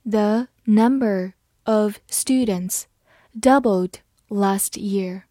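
slow, careful model reading; slow demo for learners. Shown as a demonstration. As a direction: read it slowly, word by word. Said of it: "好，慢慢来读。".

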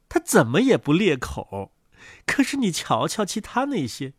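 soft, low complaint of a brooding duck; low-voiced, put-upon mother duck; grumbling mother duck. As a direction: confide it softly to a visiting neighbour.

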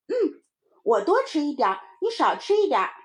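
put-on high, loud kid setting terms; bargaining little child. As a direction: lay down shares like a child. (小孩高声讲条件)

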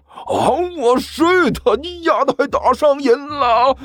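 very high, stretched-out indignation; furious outburst. A narrator's reading. Zhang Yefei sees another slap coming, furious outburst. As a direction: draw out the curse in a high squawk. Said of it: "顿时就怒了".

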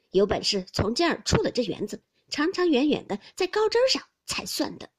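cold sneer. A narrator's reading. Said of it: "晴雯冷笑道".

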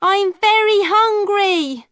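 pleading. A narrator's reading. Alison begging harder.

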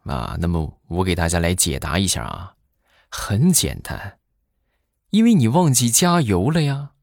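ordinary talk, nothing marked

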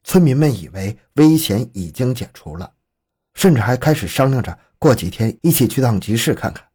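plain reading voice

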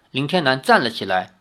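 ordinary delivery

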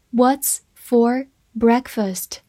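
slow and clear, word by word; slow reading. Shown as a demonstration. Teacher reads the sentence slowly to students, slow and clear. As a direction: read it slowly for the class. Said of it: "好，来看一个句子".

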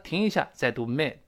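ordinary talk

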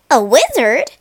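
loud startled cry. Acted. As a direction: blurt it out in shock. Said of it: "cried Leo".

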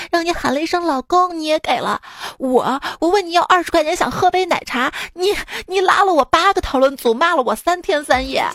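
ordinary delivery

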